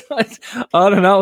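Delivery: slow, high laugh. Real, not acted. Cracking up at joke.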